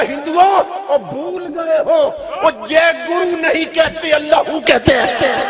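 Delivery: loud preaching call to the crowd; fervent proclamation. Acted. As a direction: proclaim it over the whole procession. Saint proclaims to the procession. (پورے جلوس سے بلند آواز میں پکار)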